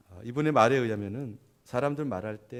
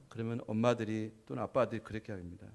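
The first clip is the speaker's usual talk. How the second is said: usual speaking voice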